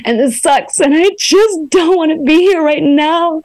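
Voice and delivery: a mock sob voiced for the shower; mock crying complaint. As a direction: play a wailing self-pity moan. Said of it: "because then you can say".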